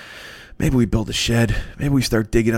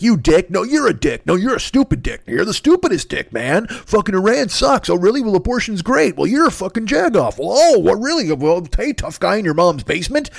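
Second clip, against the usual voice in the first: mock online argument; 8 semitones higher, 3 dB louder than usual. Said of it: "everybody's like".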